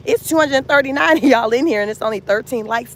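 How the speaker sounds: ordinary talk, nothing marked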